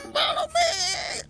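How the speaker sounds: high, slow shouted goblin call; loud high goblin beckoning. Acted. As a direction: call the party on in a squeaky yell.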